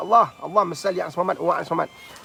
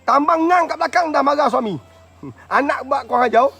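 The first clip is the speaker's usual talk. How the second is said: raised, loud scolding voice; loud mock scolding; 7 semitones higher, 7 dB louder than usual. Preacher teasing a yelling wife, loud mock scolding. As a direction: scold at high volume, acted. Mocking the wife's yelling at home.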